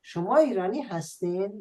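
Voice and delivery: lilting southern Persian question; southern Persian accent. Shown as a demonstration. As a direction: ask it with a hint of Shirazi accent. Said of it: "we can also say that without the accent".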